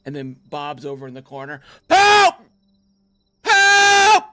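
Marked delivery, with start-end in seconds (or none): 1.9-4.2 high shrill cries for help; screaming for help. Retold or imitated. Bob screaming in corner.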